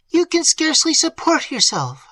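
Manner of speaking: high, loud worried reply; worried aunt. A narrator's reading. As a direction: point out her niece's weakness. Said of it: "replied her aunt".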